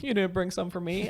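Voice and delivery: teasing complaint. Retold or imitated. Asking tripping girls for shrooms.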